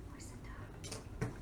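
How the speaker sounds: slow unvoiced whisper. Real, not acted. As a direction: breathe the question softly. Asking toddler softly.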